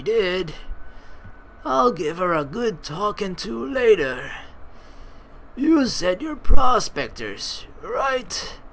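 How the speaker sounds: grandpa voice. Acted. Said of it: "roll with the grandpa voice".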